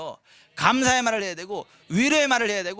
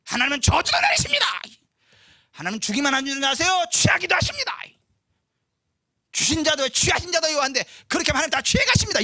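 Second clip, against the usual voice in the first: high, loud mock hellfire preacher; fear-mongering preacher; 7 semitones higher, 6 dB louder than usual. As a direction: ham up a threatening preacher. Threatening preacher mocked.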